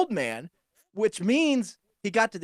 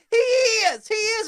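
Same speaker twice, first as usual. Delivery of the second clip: very high, louder mock squeal; excited old woman; 5 dB louder, slower than usual. Old woman confirming the funny car driver, excited squeal. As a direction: squeal it like a thrilled old lady.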